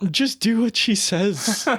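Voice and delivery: exasperated plea. Retold or imitated. Speaker urging the PM to listen, high drawn-out plea. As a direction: beg him, drawn out high. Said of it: "I was just like".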